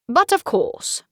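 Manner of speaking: high, theatrical count voice with a loose tooth; hammy old count lisping through a wobbly tooth. Acted. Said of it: "sounding more like a count with a funky loose tooth than anyone British".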